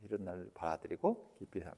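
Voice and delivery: quiet, hushed healing affirmation; softly spoken inner affirmation; soft self-affirmation. Shown as a demonstration. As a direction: murmur it softly to yourself, with acceptance. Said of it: "이렇게 한다고".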